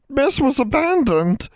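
very high, slow, wailing elf voice; a tiny elf wailing in grief; wailing house elf. A narrator's reading. Old elf wailing to Valeria's family. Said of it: "Triss wailed".

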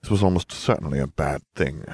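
usual speaking voice

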